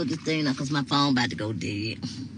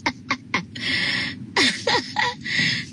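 long high airy laugh; amused laughter; 8 semitones higher than usual. Real, not acted. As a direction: laugh helplessly at a funny comment. Finds a comment hilarious.

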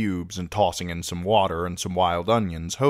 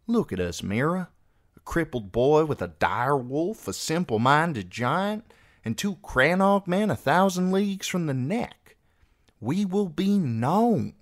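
lightly voiced boy, pitched up in warning; earnest, higher-pitched argument with deliberate pauses; grave young seer; 9 semitones higher than usual. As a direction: spell out the danger to a sister. Voices Jojen warning his sister.

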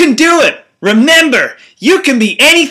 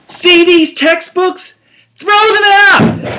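hype coach; 7 semitones higher, slower than usual. Acted. Hype coach pitching study trick.

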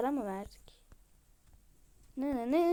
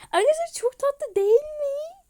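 thrilled; 13 semitones higher, 10 dB louder than usual. Real, not acted. Thrilled by new cute item.

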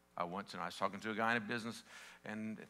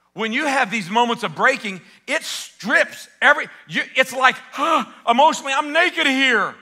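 loud, high, impassioned preaching that builds; full-force preaching; 12 semitones higher, 20 dB louder than usual. Acted. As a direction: drive it home to the congregation. Preacher builds to shouting peak.

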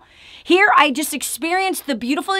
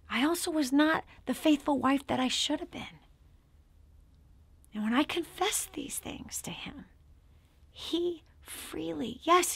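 hushed confession; 9 dB quieter than usual. Real, not acted. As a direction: confide it barely aloud.

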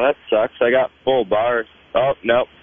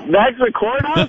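high astonished question; overplayed disbelief; shocked; 9 semitones higher than usual. Staged spit take, overreacting.

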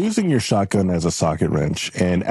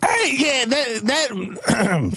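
wrong voice; 11 semitones higher, much slower than usual. Acted. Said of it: "that's the wrong voice".

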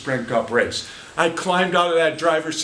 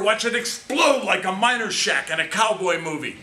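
usual speaking voice

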